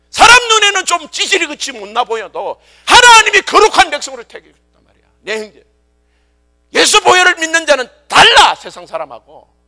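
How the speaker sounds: loud, high, impassioned sermon delivery; emphatic preacher's proclamation; full-force preaching. Real, not acted. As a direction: drive it home to the congregation.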